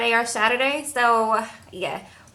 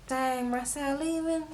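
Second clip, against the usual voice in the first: put-on quiet sad kid; 12 dB quieter than usual. Sad little boys on the couch.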